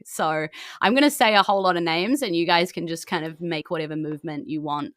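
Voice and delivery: ordinary talk, nothing marked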